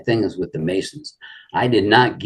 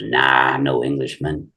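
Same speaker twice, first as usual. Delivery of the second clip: imitating Ron's drawl; 5 semitones lower than usual. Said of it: "you know that drawl he had".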